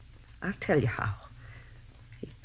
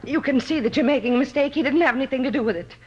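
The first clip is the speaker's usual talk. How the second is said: urgent pleading; 7 semitones higher, 5 dB louder, faster than usual. Acted. Woman pleads with arresting detectives.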